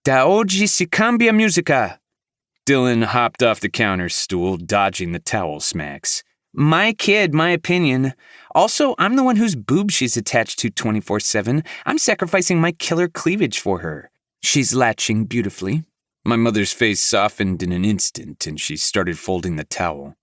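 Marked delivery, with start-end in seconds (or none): 0.0-2.0 much higher, louder Italian scold; a fiery Italian mom laying down the law; mother swatting her daughter, scolding in Italian. A narrator's reading. 6.5-15.8 put-on much higher, sassy young woman; new mother defending herself to her mom, sassy and high; defiant young mother. A narrator's reading. Dylan voiced by narrator.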